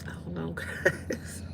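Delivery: breathy whisper with a laugh; soft laughing whisper to viewers. Real, not acted. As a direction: whisper it through a giggle.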